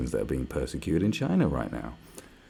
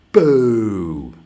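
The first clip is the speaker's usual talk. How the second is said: loud boo; 12 dB louder than usual. Shown as a demonstration. Showing people how to boo China, loud and long. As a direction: jeer it out at full volume. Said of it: "hold a football and go".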